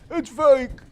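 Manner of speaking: high, loud reveal; excited reveal. Real, not acted. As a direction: call out the answer. Announcing the answer.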